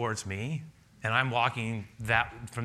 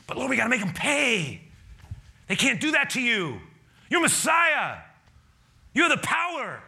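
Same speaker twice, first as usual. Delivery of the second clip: much higher, louder indignant disciple; eager disciple voice; 12 semitones higher, 5 dB louder than usual. Acted. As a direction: urge the Lord to strike back. Disciples urging revenge.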